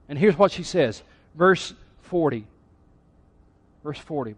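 ordinary talk, nothing marked

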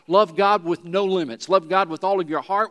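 a touch of sermon emphasis; preaching. Acted. Pastor exhorting the congregation, firm preaching. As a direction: urge the congregation to love God fully.

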